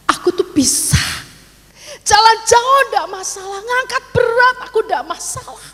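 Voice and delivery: retold tearful plea, pitch climbing high; hampir menangis. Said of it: "sampai hampir nangis, saya tuh ngomong". (keluhan pilu hampir menangis)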